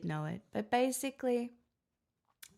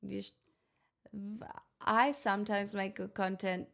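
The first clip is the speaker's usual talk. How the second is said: normal talking voice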